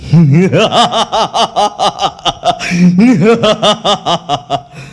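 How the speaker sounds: booming, rolling stage-villain laugh. Acted. Ravan on stage, laughing off Ram's arrow with a booming villain laugh. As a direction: laugh off the arrow like a proud demon king. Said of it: "Ravan दो तीर में हंसना है".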